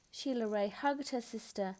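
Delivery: ordinary delivery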